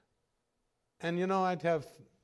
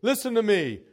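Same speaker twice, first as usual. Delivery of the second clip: loud, higher ranting call; 8 semitones higher, 9 dB louder than usual. Retold or imitated. Preacher ranting at his African congregation, loud. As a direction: shout for attention like a preacher on a tirade. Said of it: "rant and rave".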